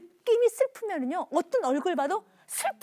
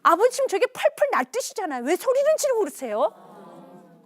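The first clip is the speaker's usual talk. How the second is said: put-on higher-pitched teenage protest; indignant accusation; 4 semitones higher, 4 dB louder than usual. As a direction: push back at a parent, raised and offended. Son accuses father, then storms out.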